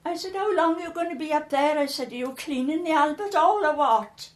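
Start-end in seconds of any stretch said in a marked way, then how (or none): 0.3-1.8 higher, louder retold call upstairs; raised-voice, impatient holler; shouting up. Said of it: "I shouted up to him". 2.1-4.3 loud, higher-pitched retold holler; exasperated call to someone upstairs; shouting up. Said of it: "I shouted up to him".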